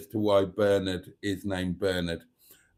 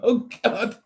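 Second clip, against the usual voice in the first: high-pitched helpless laughing fit through the words; can't stop laughing, squeaking out the words; real laughter; 11 semitones higher, 4 dB louder than usual. Real, not acted. Reading a viewer's joke aloud, breaking into high laughter. Cracking up at Hubnutted comment.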